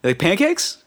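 high teasing dad voice; imitating dad. Friend's dad teasing a guest, high and playful. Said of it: "He's just like".

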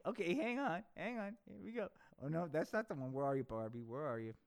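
usual speaking voice

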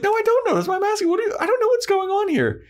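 very high, fast, frantic whine; hammed-up confused boyfriend, pitched very high; exasperated mock Angel. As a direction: squeal it like a flustered boyfriend. Angel exasperated in retold quarrel.

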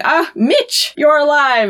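high, hammed-up gasp of recognition; amazed relief. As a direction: play an actress astonished to find someone. Actress finds Mitch alive.

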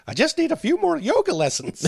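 very high cartoon wife impression; Marge. Wife putting off her husband, high Marge impression. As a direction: put on a strained, high-pitched cartoon housewife. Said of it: "your Marge there is almost better".

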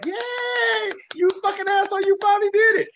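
put-on like a thrilled kid clapping his hands; excited little boy. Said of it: "the little boy in me was clapping like".

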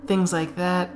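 lightly voiced handler talking a captive round; coaxing captor. Captor to weeping man in a cell, mildly coaxing. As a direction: cajole with a hint of false reassurance.

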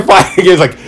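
usual speaking voice

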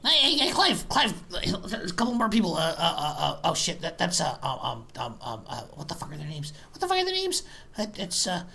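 put-on drunk goblin barkeep.

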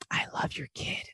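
soft, voiceless praise; creepy whisper. Acted. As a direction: whisper it unsettlingly to a parent. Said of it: "there's more danger in whispering".

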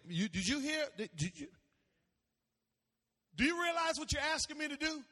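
stammered start, then high rushed question; acting out a frightened, hesitant man; afraid, incredulous. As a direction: balk at the request in mock alarm. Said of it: "he was afraid".

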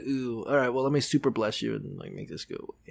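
soft, low mock climb-down; backpedaling. Priest backing down from accusing a sad woman, soft backpedaling. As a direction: back off quietly, imitating an awkward priest. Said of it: "like, backpedaling".

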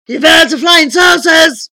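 loud, high booming question; jovial giant. A narrator's reading. Giant wizard jokes about his invention, jovially booming. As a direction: bellow it as a big joke. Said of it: "he laughed".

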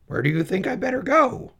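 put-on high, lonely young pig voice; high-pitched bored piglet; Wilbur the pig. Wilbur asks the goose.

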